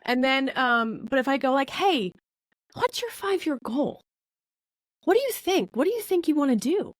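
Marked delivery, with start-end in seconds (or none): 1.7-6.9 higher, sincere, friendly ask; upbeat, generous question; kind and convinced. Shown as a demonstration. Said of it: "you say it with conviction, and you say it with kindness".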